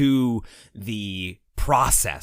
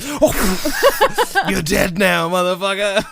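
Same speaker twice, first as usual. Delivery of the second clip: laughing mock threat; 10 semitones higher, 9 dB louder, much slower than usual. Villains greet him at airport.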